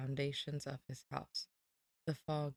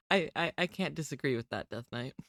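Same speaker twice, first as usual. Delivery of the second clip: ordinary delivery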